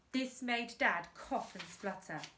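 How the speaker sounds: plain reading voice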